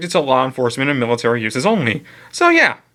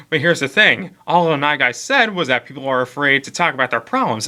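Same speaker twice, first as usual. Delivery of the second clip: usual speaking voice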